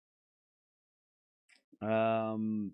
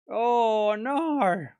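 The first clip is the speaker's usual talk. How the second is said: mock dismay; 5 semitones higher than usual.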